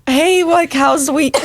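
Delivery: high, put-on breezy hello; mock casual greeting. Imagining small talk with parents instead of confessing, mock casual.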